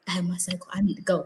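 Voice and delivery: low, hushed confidential voice; discreet undertone. Retold or imitated. Student privately telling teacher about her cycle, low and hushed. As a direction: say it very softly, for one ear only. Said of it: "I didn't wanna go up to her and be like".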